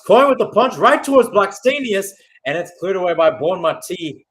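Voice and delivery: sudden lift into a loud, high call of the save; excited call. Real, not acted. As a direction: jump up with the action as the keeper punches.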